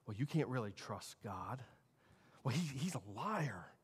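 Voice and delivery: hushed, low insinuation; Satan. Retold or imitated. Tempter coming to Eve in the garden, hushed insinuation. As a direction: plant the doubt softly and low. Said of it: "that's how he came to Eve".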